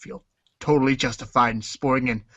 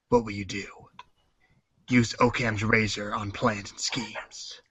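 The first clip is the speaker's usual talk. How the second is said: moody noir narration; 5 dB quieter than usual. Acted. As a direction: set a sinister mood. Narrating noir gang intro.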